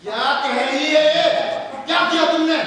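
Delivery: scolding father demanding answers; stern confrontation. Acted. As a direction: confront the child loudly, like an angry father. Father confronts son over complaint.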